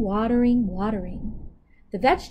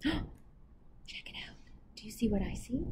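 excited whisper; 14 dB quieter than usual. Real, not acted. Reader spots the people in the picture, excited whisper. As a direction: breathe it like a discovery.

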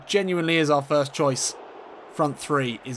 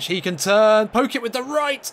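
excited; 6 semitones higher, 4 dB louder than usual. Real, not acted. Commentator's build-up to a shot.

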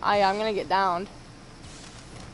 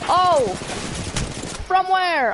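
panicking gamer; 6 semitones higher, much slower than usual. Real, not acted. Being shot at, can't see enemy.

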